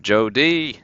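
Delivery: ordinary delivery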